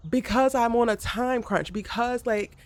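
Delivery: defending herself, fed up; frustrated outburst. Real, not acted.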